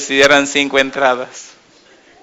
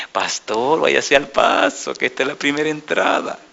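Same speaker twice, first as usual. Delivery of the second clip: discreet hushed aside; 7 dB quieter than usual. Retold or imitated. Pastor quietly warning his guest across the dinner table, hushed and smiling. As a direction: murmur it like you're saying something else. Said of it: "sonriéndose, como si me estuviera diciendo otra cosa".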